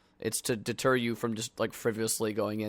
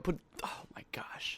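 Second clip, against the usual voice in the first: whispered aside; 4 semitones higher, 12 dB quieter than usual. Acted.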